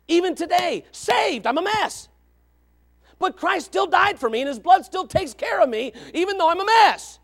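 high, ringing testimony; impassioned preaching. Real, not acted. Preacher confessing he is saved yet flawed, impassioned. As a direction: proclaim it with rising fervor.

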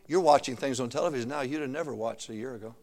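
ordinary talk, nothing marked

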